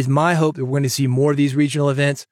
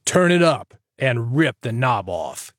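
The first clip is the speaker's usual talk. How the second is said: slow, big announcer voice; booming rock-radio announcer; radio guy voice; 2 semitones lower, slower than usual. Shown as a demonstration. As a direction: ham it up like an old-school DJ. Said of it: "Maybe be a radio guy".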